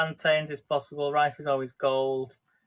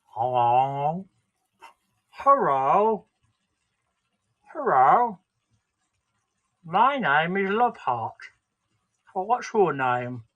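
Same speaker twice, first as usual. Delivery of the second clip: puppet voice; 5 dB louder, much slower than usual. Pretend. Puppet voice with love heart.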